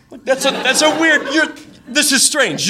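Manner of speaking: high, laughing "this is strange"; laughing disbelief. Retold or imitated. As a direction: laugh through the confusion at her joke. Bewildered reaction to weird joke.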